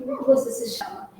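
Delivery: put-on soft, high, breathy question to a little boy; gentle whisper to a child. Woman crouching, asking the little boy his name, whispered. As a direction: lean down and whisper it kindly.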